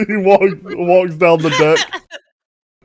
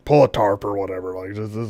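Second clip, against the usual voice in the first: low, hushed aside to the crew; whispering; 6 semitones lower, 7 dB quieter than usual. Acted. Weary captain murmuring to deckhands, hushed. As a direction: keep it down, just for the deckhands. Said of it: "he whispers to some deckhands".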